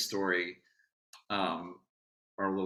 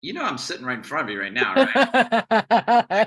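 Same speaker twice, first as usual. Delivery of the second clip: friend teasing; 10 semitones higher, 9 dB louder than usual. Retold or imitated. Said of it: "And she was like".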